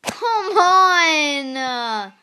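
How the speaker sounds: loud, exasperated come on; frustrated yell. Real, not acted. Frustrated at the game.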